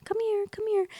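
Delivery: high coaxing kitty voice; calling a shy cat over in a high voice. Retold or imitated. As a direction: coax the kitten out, sweet and high. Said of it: "I was like".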